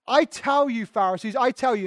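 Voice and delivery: jab the words at the Pharisees; preacher quoting Jesus as a rebuke; emphatic warning. Retold or imitated. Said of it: "he's pointing them right in the face".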